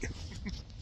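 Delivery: brief, soft chuckle at own joke; small real laugh; short laugh. Real, not acted. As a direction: chuckle quietly after the punchline.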